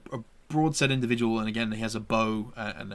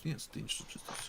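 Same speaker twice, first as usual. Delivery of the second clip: hushed echo; 13 dB quieter than usual. Acted. GM voices second hunter echoing.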